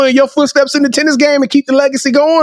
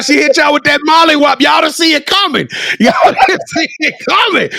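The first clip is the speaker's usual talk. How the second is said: higher, shouted hype with laughter; playful hype-man holler; hyped-up teasing; 5 semitones higher than usual. Real, not acted. Host hyping guest's tough slang.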